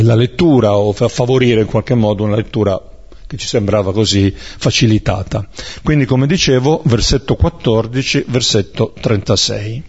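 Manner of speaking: normal talking voice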